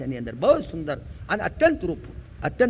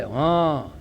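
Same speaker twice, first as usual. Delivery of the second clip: lightly voiced stone, low drawn-out reply; a hint of an echoing answer, sinking low; 5 semitones lower than usual. Stone answers Vyas's call, lightly voiced low reply. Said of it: "પથ્થર બોલે".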